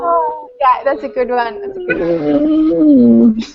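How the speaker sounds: slow, high, loud gush; drawn-out delighted gush. Real, not acted. Swooning at the quote.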